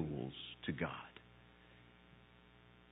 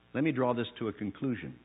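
usual speaking voice

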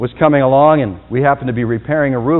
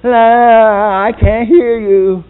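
loud, high sing-song of someone not listening; childish loud sing-song denial; mocking la la la; 9 semitones higher, 8 dB louder, much slower than usual. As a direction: fingers in ears, drown out the bad news. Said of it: "put your fingers in your ears and go".

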